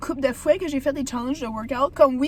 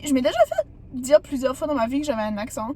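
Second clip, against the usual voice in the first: ordinary talk, nothing marked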